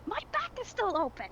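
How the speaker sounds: high, loud whining complaint; high whiny cry. Acted. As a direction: wail it like a whiny kid. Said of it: "goes like".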